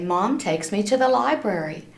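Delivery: usual speaking voice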